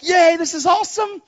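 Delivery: high-pitched imitated glee; psyched and happy. New believer thrilled about working for the Lord, happy. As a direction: cheer like you are so psyched. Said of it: "so psyched and so happy".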